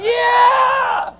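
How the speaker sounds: long, high screamed "yeah"; wild cheer. Acted. Preacher acting out Peter walking on the water, wild cheer. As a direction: scream it out in triumph, falling at the end.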